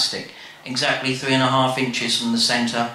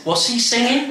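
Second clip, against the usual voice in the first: put-on much higher, puzzled old Nan; 11 semitones higher than usual. Nan asks the family about the record, high and puzzled. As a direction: pitch up into a bewildered grandmother. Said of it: "Nan says".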